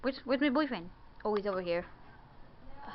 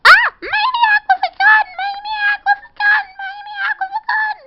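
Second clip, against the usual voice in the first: screaming high and loud in mock panic; panicked shout; 15 dB louder than usual. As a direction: yell for help as if a gunman burst in. Panicked alarm, repeated.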